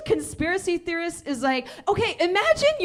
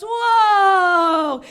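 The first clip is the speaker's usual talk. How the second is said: loud, high cry of fright; falling cry; 5 semitones higher, 8 dB louder than usual. Acted. The rabbit falls from the sky, a loud high cry. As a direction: yelp as you tumble. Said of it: "Rabbit goes".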